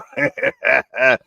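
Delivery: loud, quick burst of laughter. Real, not acted. Streamer amused by his own pep talk, laughing loudly. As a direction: crack up at your own words.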